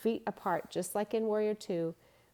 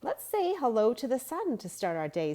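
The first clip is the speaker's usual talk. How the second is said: higher, louder cheery teacher; cheerful teacher voice; 6 semitones higher, 4 dB louder than usual. A narrator's reading. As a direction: invite the class warmly and brightly. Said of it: "said Luke's teacher".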